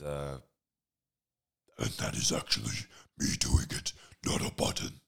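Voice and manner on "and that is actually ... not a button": loud guttural villain growl; Doctor Claw. Acted. Proving to listeners it is really him, growled villain rasp. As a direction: keep the raspy cartoon villain voice going. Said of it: "Doctor Claw impression".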